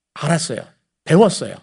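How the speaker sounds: normal talking voice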